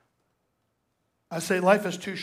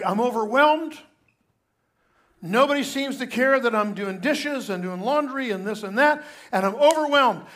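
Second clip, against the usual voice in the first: higher, louder mock overwhelmed wife; imitating upset wife; 5 semitones higher, 6 dB louder than usual. Pastor voicing his overwhelmed wife, mock distressed. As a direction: act out the frazzled wife, hammed-up. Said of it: "she'd say something like".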